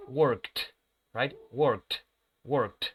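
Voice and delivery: "worked" ending in a sharp t; final t sound. Shown as a demonstration. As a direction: close "worked" on a crisp t. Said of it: "El último sonido que yo pronuncio es t".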